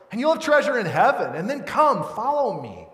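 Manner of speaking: loud, high preacher's appeal; impassioned invitation. Retold or imitated. Jesus calling the rich man to follow, impassioned. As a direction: call out the invitation with rising force.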